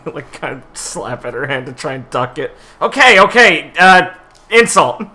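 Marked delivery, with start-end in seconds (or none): 2.8-5.0 loud, high flustered answer; flustered outburst. Real, not acted. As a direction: blurt it out, caught off guard. Player flustered into answering the test.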